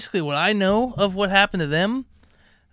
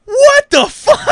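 much higher, louder shrieking mom; 13 dB louder, much slower than usual. Retold or imitated. Mother hearing her kid got drunk, shrieking outrage.

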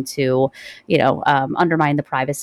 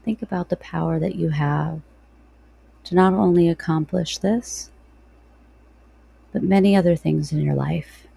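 guided meditation. Real, not acted.

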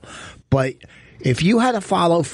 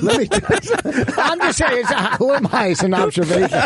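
laughing defensively; 9 semitones higher than usual. Real, not acted. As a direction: defend yourself while laughing at the joke. Answering the joke, laughing.

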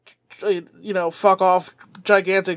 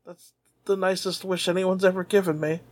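ordinary talk

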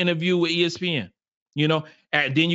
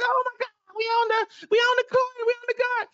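put-on high squealing girl voice; excited young girls. Young fan squealing to her friend on the court, very high and excited. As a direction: squeal it like a thrilled little girl.

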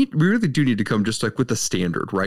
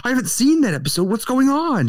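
high, startled "wait a second"; panicking at a spoiler, pitched way up; surprised viewer; 11 semitones higher than usual. Retold or imitated. Viewer hearing an Ozark clip, startled and high. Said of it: "And you're like".

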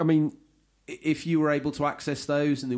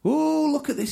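high, excited mock exclamation, much higher; voicing a listener; 5 dB louder than usual. Host voicing a listener discovering Sony's toolbox, high mock excitement. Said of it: "he says".

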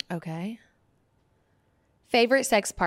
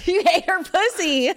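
laughing accusation, slow; 7 semitones higher, 4 dB louder, slower than usual. Real, not acted. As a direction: laugh through the words.

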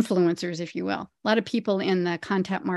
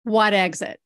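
put-on emphatic New Jersey accent. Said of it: "a New Jersey accent".

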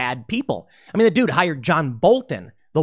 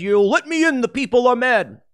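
higher, mildly whiny plea at the door; weak pleading Bernie; 7 semitones higher than usual. Retold or imitated. Bernie knocks at the ivory tower, higher mild plea. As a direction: play a weak man begging to be let in. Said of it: "knocked on the door and said".

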